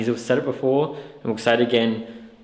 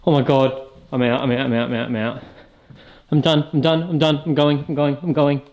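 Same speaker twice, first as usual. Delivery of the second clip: louder, higher frantic repeating; panicked exit; 4 semitones higher, 4 dB louder than usual. Real, not acted. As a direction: panic fleeing the bat. Fleeing bats panicked.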